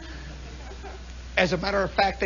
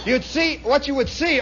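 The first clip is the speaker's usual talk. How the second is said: ordinary talk, nothing marked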